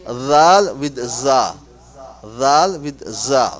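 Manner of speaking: "dhal" and "za" drawn out to contrast th and z; contrasting two letters. Shown as a demonstration. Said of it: "they change".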